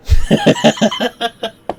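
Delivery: loud high burst of giggles tailing off; high giggling laugh. Real, not acted. Amused at his own PTSD plot twist, giggling. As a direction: crack up in high, rapid giggles.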